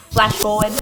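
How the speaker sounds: usual speaking voice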